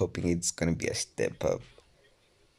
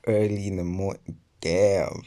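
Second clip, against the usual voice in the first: ordinary talk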